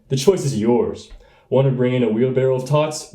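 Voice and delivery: normal talking voice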